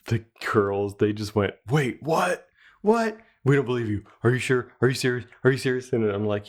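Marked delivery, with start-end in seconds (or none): 1.7-5.9 imitated kids' astonished questions, pitched up at first; stunned disbelief. Daughters doubting dad's yes, imitated amazement.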